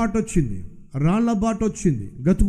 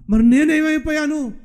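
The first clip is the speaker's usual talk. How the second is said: higher, puzzled question; bewildered believer; 7 semitones higher than usual. Acted. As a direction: ask in disbelief, higher. Believer asks God, acted dialogue.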